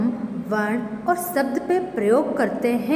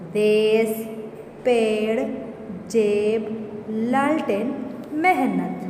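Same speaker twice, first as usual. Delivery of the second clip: classroom word drill. Shown as a demonstration. Teacher drills A words.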